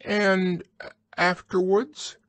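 high, strained little Piglet question; small high voice trying to get words out; husky. A narrator's reading. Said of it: "managed to say very huskily".

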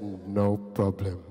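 very deep, slow, thick voice; rich man. Retold or imitated. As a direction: let it roll out low and slow. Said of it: "I heard the voice of a rich man".

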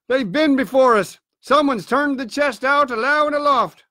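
called-out report. A narrator's reading. Man at the window reports to the beggar, calling down. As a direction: call the bad news down. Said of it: "he cried".

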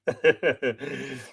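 short, easy chuckle; amused chuckle. Real, not acted. Host greeting a waving viewer, amused chuckle. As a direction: give a little pleased laugh.

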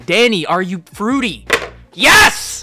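loud question leaping to a high shout; exasperated yell. Acted. YouTuber answering what fans always ask, exasperated yell. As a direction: build to a high, exploding yell.

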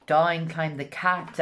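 ordinary delivery, nothing marked

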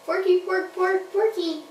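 a lightly squeaky puppet ask; puppet question. Pretend. Puppet Peter asks Jesus, lightly squeaky. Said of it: "And Peter said".

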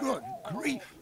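breathless unvoiced good grief; whispered shock. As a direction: gasp it out in disbelief. Character shocked by recording.

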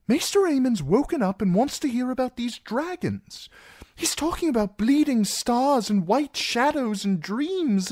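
very high, earnest entreaty; fretful, high-pitched plea; pleading, anxious Sam. Acted. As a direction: beg a friend for help. Plays Sam pleading for help.

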